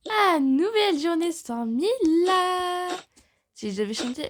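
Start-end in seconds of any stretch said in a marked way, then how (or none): 0.1-2.9 loud, high, slow delighted cry; gleeful sigh. Acted. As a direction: savour the relief out loud. Gleeful exclamation.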